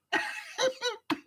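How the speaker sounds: squeaky high laugh, mostly air; high breathy giggle. Real, not acted. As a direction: let a high giggle slip out. Laughing at the memory.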